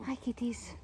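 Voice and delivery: soft whispered hello to the cats; hushed greeting. Real, not acted. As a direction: whisper so you don't startle them.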